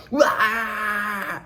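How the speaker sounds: high mock crying wail; mock panic wail. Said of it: "they all go".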